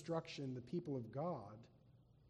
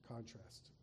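soft echoed word; 5 semitones lower, 11 dB quieter than usual. Shown as a demonstration. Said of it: "Here's the stark contrast".

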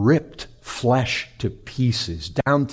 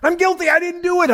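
much higher mock plea of a condemned man; pleading criminal; faster than usual. Preacher imitating a condemned criminal begging for mercy. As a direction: squeak out a desperate criminal's protest. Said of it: "you know what condemned criminals are like?".

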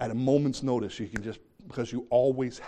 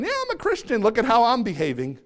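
mocking careless boast, much higher; flippant; 9 semitones higher, 5 dB louder than usual. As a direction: toss it off like a smug hypocrite. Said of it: "flippantly saying".

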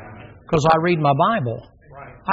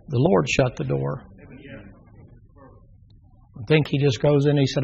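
ordinary delivery

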